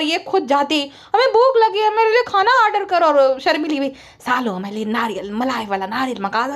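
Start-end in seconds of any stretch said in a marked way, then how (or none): 1.1-3.1 high-pitched mock demand; mimicked demanding woman. Vlogger mimicking her demanding food, mock demanding. 4.2-6.6 low, softer put-on voice; mimicked gruff demand. Vlogger mimicking another woman ordering coconut, low mock demand.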